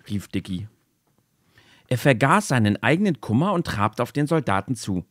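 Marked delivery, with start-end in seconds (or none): none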